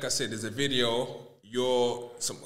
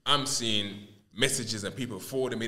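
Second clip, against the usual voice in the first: normal talking voice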